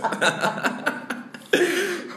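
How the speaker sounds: high burst of laughter. Real, not acted. Student laughs hard, high.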